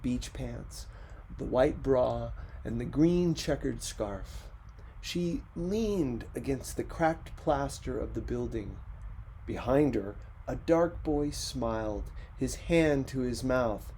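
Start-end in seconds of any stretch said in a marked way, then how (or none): none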